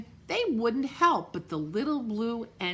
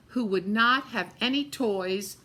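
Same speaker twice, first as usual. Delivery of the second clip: usual speaking voice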